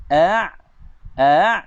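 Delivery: A glottal catch then a throaty Ain; A then a pressed from the throat; Hamzah meets Ain. Shown as a demonstration. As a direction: demo of a Hamzah running into an Ain. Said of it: "ni Hamzah dengan Ain".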